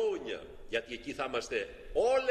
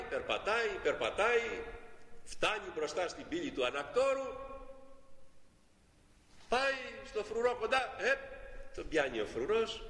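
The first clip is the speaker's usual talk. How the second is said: ordinary talk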